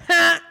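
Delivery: one high, abrupt "Ha!"; aggressive, insulting laugh. Shown as a demonstration. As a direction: fire off a single mocking burst of laughter. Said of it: "My laugh is very aggressive and insulting".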